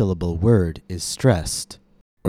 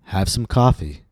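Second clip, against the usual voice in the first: first syllable of "coffee" lengthened and raised; stress on coffee. Shown as a demonstration. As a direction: lift and stretch the COF of "coffee", then fall. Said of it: "Notice how the first syllable of coffee".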